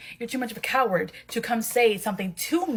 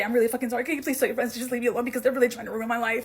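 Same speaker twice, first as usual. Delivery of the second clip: rushed, frantic apology in one breath; too fast; faster than usual. Acted. Neighbour blurting an apology to Bakugo, too fast. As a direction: blurt it all out at speed. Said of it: "saying that a little bit too fast".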